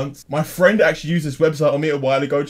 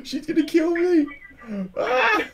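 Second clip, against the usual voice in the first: panicked gasp; slower than usual. Real, not acted. Friend's mum answered prank call.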